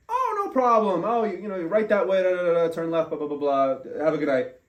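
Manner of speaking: high, loud breezy cop giving directions; cheery cop. Retold or imitated. Cop cheerfully sending the lost driver home, high and breezy. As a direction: brush it off like a friendly officer.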